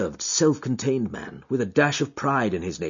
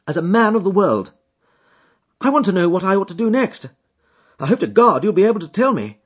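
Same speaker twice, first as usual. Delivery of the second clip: louder, raised, pleading voice; desperate client; 10 semitones higher, 5 dB louder than usual. Acted. Troubled husband pleading with Holmes, distressed. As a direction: beg the detective for help.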